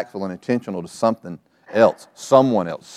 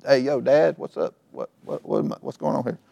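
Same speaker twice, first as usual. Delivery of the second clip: confused Isaac; 5 semitones higher, 4 dB quieter than usual. Retold or imitated. Isaac voiced, confused and scared.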